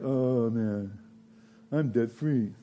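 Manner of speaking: mock downcast sigh; a touch of fake gloom; mock mournful. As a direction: play it glum for laughs. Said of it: "you'd be all mournful and downcast".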